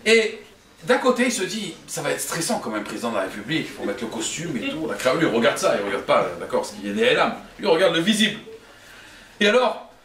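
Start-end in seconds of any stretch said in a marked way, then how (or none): 1.9-4.9 low, soft inner voice; worried musing. Acted. New president-to-be fretting to himself, low worried musing. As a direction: think it through anxiously under your breath.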